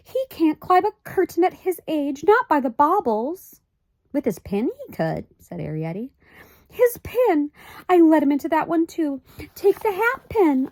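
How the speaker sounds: high, anxious mother's voice; fussy high-pitched mother; Homily, fretful mother. A narrator's reading. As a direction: voice a worried little mother. Homily's voice in the story.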